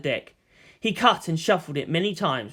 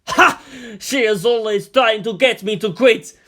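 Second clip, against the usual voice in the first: loud, high, delighted bark of a laugh; gleeful outburst; 6 semitones higher, 8 dB louder than usual. A narrator's reading. Premier crowing to the ambassador, loud and gleeful. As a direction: burst out in wild glee. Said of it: "wide-eyed glee".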